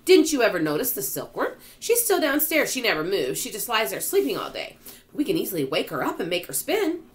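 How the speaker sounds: high, loud eagerness; excited cry. A narrator's reading. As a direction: explain in an excited rush of enthusiasm. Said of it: "cried the old green grasshopper".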